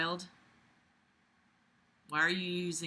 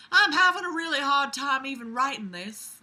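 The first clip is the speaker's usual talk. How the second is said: put-on higher-pitched Southern belle accent; [name], Southern belle; 5 semitones higher than usual. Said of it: "my Southern Belle accent".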